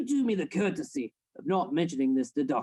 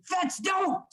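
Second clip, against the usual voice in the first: sharp high shout of alarm; urgent high warning shout; shouting to stop him; 12 semitones higher than usual. Acted. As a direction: yell to stop him in his tracks. Stopping him from acting.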